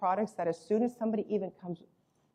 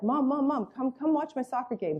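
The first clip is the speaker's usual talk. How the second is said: put-on higher-pitched kids begging for mom; lightly voiced eager child; pestering children; 5 semitones higher than usual. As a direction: imitate children pleading for attention. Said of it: "My children are like".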